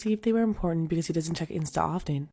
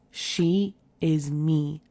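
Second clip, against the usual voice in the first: slow reveal; much slower than usual. Real, not acted. Punchline reveal of story.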